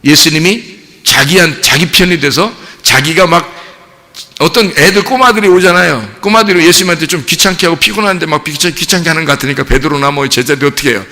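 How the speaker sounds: normal talking voice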